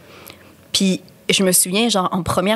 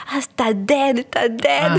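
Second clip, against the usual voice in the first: higher, put-on Montreal street-slang accent; 5 semitones higher, much slower than usual. Recalling slang picked up at call centre, imitated slang accent. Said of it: "j'ai pris aussi comme du slang".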